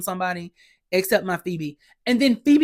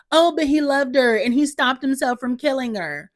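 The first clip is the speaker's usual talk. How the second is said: higher, whiny-style protest in mockery; mocking objection; 5 semitones higher than usual. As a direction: parrot someone's excuse mockingly. Mocks an excuse she rejects.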